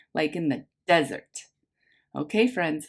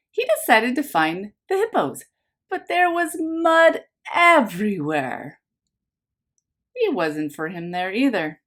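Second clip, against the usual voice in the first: bright storybook read-aloud, a little louder; lively picture-book narration for a class; reading to children; 3 semitones higher, 4 dB louder than usual. A narrator's reading. Teacher reads picture book aloud.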